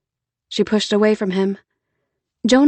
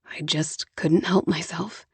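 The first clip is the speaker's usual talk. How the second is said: Jonah, softly; 3 semitones lower, 7 dB quieter than usual. Acted. Jonah voiced.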